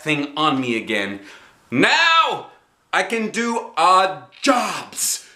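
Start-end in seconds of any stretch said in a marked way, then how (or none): 1.7-5.3 put-on high, slow thrilled child; bursting with pride at new tools; real thrilled. A narrator's reading. Little girl thrilled with her tool belt. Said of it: "I said, real thrilled".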